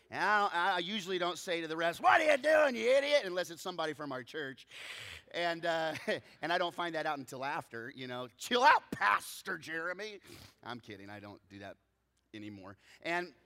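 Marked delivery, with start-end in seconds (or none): 2.0-3.2 high, loud mock heckle; exasperated sideline shout, played for laughs; re-enacted yell at referee. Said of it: "I do like to yell". 8.4-10.2 raised, louder retort; high, pointed telling-off; mock rebuke. Retold rebuke from a spectator.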